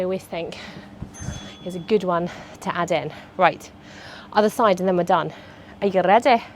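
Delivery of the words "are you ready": put-on light Scottish accent; a playful hint of Scottish on Are you ready?. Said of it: "Don't know why I've gone Scottish".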